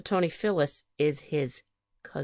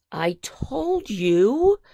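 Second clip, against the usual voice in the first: slow, drawn-out, higher-pitched gloating; told-you-so; 5 semitones higher, 4 dB louder, much slower than usual. A narrator's reading. Phyllis, proved right about the cold, slowly says told-you-so. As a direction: stretch out every word to rub it in. Phyllis begins her vindication.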